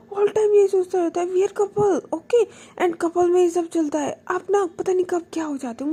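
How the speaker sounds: loud, high exasperated scolding; fed-up girlfriend raising her voice; annoyed complaining. Retold or imitated. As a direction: nag your partner in frustration. Couple quarrel, Baani complaining.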